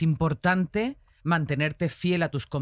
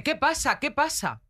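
mock angry driver; 8 semitones higher than usual. Angry driver retold in story.

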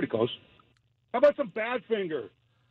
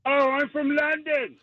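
put-on London accent; 4 semitones higher than usual. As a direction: insist on it in a fake London accent. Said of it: "That is a great English accent".

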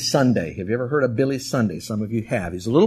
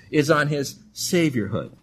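normal talking voice

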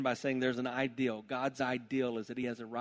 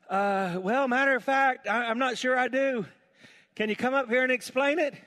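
a hint of an unsure official, higher and louder; lightly voiced eunuch; 12 semitones higher, 10 dB louder than usual. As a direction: answer a bit bashful from the chariot. Eunuch answers Philip.

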